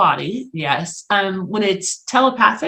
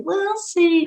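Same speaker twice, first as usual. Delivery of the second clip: much higher, imitated sceptical line; doubting; 10 semitones higher than usual. Mom who didn't believe in predictions, doubting. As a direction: lift it high, like an unconvinced mother. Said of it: "She would always say".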